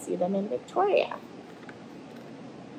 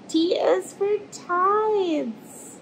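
gasp, then a much higher, slow, wondering reveal; excited gasp; 10 semitones higher, much slower than usual. Acted. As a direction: gasp as if spotting treasure, then draw it out high. Excited discovery while reading aloud.

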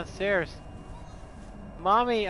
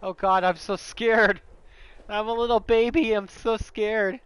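playing a scared little baby, dragged out and loud; mock baby fright; 3 semitones higher, 6 dB louder than usual.

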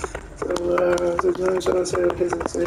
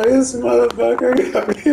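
louder, higher put-on gripe; mock outraged enemy; 4 semitones higher, 4 dB louder than usual. Defeated opponent quoted in a louder, higher voice. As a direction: grumble as the beaten player, a bit loud. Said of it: "He said".

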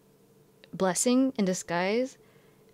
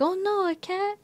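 much higher, sing-song "oh no"; cutesy gasp; 13 semitones higher than usual. Retold or imitated. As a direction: coo it like a fussy teacher. Teachers fussing over the cat.

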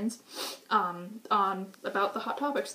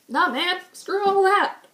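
higher and louder, slow scoff; loud dismissal; 9 semitones higher, 8 dB louder than usual. Pretend. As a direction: brush it all off with a shout. Mocking mainstream, loud dismissal.

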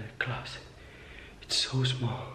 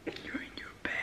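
whispering; 8 dB quieter, much faster than usual. Real, not acted. Friend sleeping.

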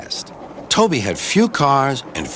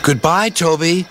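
high-pitched goodbye call; passengers' farewell; 8 semitones higher than usual. A narrator's reading. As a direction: bid farewell in a raised, high voice. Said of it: "said the passengers afterwards".

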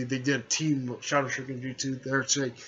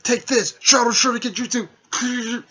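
much higher and louder jutsu battle cry; battle shout; 11 semitones higher, 8 dB louder than usual. Retold or imitated. Naruto attacks the puppet girl, yelling his jutsu. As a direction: yell the technique name as you attack.

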